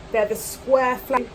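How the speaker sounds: ordinary talk, nothing marked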